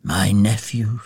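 understated regretful sigh. A narrator's reading. Scrooge thinks of his nephew, sighing. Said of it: "sighed Scrooge".

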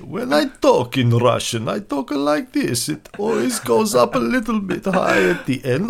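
thick put-on Russian accent, pitch swooping high; showy mock Russian accent with high lilts. Shown as a demonstration. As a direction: talk like a hammed-up Russian, rising at the ends. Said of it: "doing the best Russian accent".

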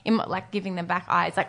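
ordinary talk, nothing marked

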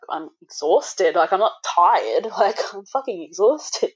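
slow, stretched stress on "exhausted", set against "tired"; emphatic exasperation. Retold or imitated. New mum corrects midwife's word, stressing "exhausted" with slow exasperation. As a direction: draw out "exhausted" and lift the pitch on "tired".

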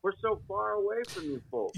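distant phone voice; far from mic. Real, not acted. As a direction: sounds far off on the line. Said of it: "You sounded real far away right then".

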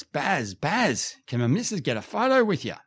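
high-pitched eager call, quickening into the request; fan calling out. Retold or imitated. Fan hailing a TV star for a photo, calling out high. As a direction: call out Baz's name in a high voice. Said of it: "I hear this voice".

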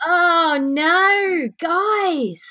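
high, pained oh no; shocked dismay. Real, not acted. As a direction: recoil in horror. Reacts to the news with disgust.

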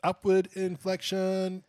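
UPWARD INFLECTION held high and loud on one note; failed upward inflection. Shown as a demonstration. Host demonstrates to his co-host, UPWARD INFLECTION drawn out high and monotone. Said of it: "Oh, no, that was monotone".

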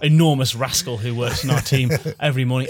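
ordinary talk

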